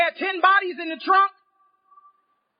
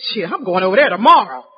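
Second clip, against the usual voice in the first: eager thought; 9 semitones lower, 6 dB louder than usual. Retold or imitated.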